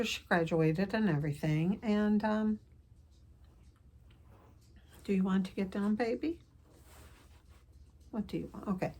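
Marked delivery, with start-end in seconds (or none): 5.0-8.9 cooing to pet. Real, not acted. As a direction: gently ask the pet what it wants. Talking to her pet again.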